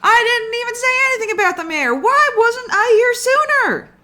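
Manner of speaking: very high, loud, fast complaint; shrill yelled retort; exasperated yell. Acted. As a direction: Momi shouts back, indignant. Momi yelling back.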